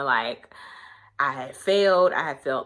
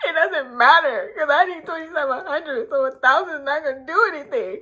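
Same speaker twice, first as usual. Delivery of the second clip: high, whiny sob-talk; a hammed-up tearful complaint to a friend; teary whine; 11 semitones higher, 4 dB louder than usual. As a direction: complain through mock tears. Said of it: "I was like".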